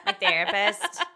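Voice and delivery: a light American accent on "My therapist". Shown as a demonstration. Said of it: "I always say it in an American accent".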